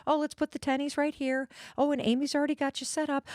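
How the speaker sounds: imitating a kind, fussing desk clerk; sweet fussing host. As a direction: coo over her like a caring friend. Voicing the sweet receptionist.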